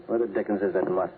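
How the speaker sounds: usual speaking voice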